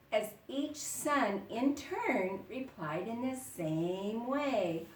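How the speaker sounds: ordinary delivery, nothing marked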